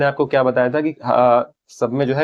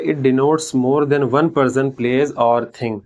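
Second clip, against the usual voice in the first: very slow, every English word spaced out; slow dictation; much slower than usual. Shown as a demonstration. Teacher dictating the definition to students, very slow and spaced. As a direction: read it out slowly so students can write it down.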